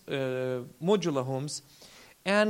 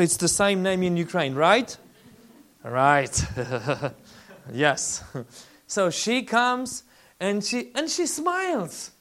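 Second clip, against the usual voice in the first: normal talking voice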